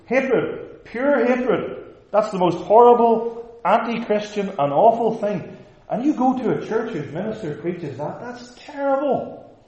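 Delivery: high, loud, scandalized objector; mock outraged shouting. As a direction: cry it out in horrified indignation. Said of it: "they probably would have shouted it out".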